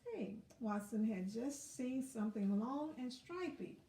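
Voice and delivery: ordinary delivery, nothing marked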